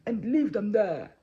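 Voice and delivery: loud royal command; big, forceful order, slowing at the end; booming king's voice. Acted. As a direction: boom out the sentence as a tyrant. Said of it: "in his booming voice".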